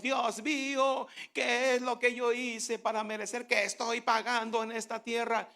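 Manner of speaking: mock self-pitying lament. Preacher mimics a self-pitying prayer.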